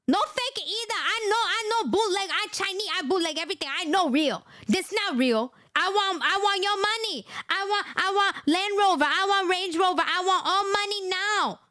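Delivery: mock-accented greedy demands; mock Chinese accent. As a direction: caricature listing luxury wants. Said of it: "Xi Jinping say".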